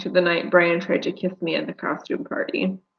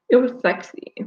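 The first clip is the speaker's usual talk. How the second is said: snorting; 6 semitones higher than usual. A narrator's reading. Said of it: "the stranger snorts".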